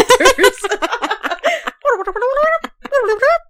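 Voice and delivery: squeaky high laughing gibberish; Animal Crossing babble. Retold or imitated. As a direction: babble like a game villager. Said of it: "we sounded like Animal Crossing characters".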